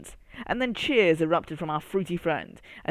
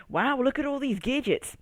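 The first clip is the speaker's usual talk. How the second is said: high, eager gasp at the gadgets; wide-eyed wow at the gadgets; excited exclaim; 9 semitones higher than usual. A narrator's reading. Grape marvels at the lab gadgets, excited. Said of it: "exclaimed gallivanting grape".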